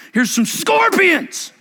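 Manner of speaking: high, slow put-on dad voice; a hammed-up father handing over scorpions; mock sweet dad. Dad mockingly answers child.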